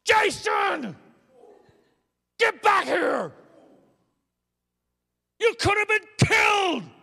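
very high, very loud frightened yell; panicked parent shout. Retold or imitated. Father yelling at his son in the street, terrified shout. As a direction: scream at the child to come back. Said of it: "I watched my kids ride their bikes".

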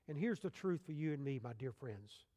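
usual speaking voice

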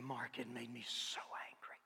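whispered, admitting the anger under his breath; hushed angry confession. Acted. Peter confides his anger.

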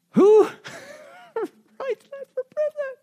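high laughing disbelief, slowing down. Real, not acted. To the congregation, a high laughing disbelief. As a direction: laugh at the absurdity of it.

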